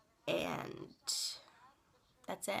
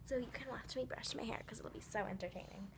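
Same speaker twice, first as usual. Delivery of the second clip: whispering; 8 dB quieter than usual. Real, not acted. Ironic aside while brushing hair.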